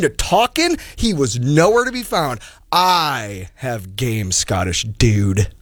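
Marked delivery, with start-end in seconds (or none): 2.7-5.5 slow, low boast. Acted. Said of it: "slow low retort".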